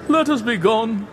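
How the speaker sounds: Fortunato pleading. A narrator's reading. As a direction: beg to leave, faking calm. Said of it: "a sad voice".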